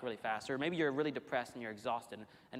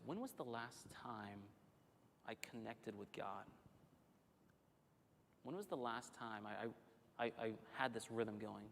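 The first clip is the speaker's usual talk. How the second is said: soft, slow reflection; 3 semitones lower, 10 dB quieter than usual. Acted.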